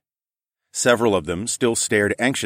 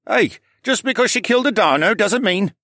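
much higher voice piping up; objecting local; 14 semitones higher, 4 dB louder than usual. A narrator's reading. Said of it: "another man piped up".

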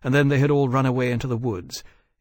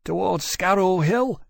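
trembling voice; 7 semitones higher than usual. A narrator's reading. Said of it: "his voice still trembling".

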